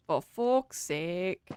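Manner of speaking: slow, Scottish-tinged curse; almost Scottish. Real, not acted. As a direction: draw it out with a near-Scottish lilt. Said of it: "almost Scottish".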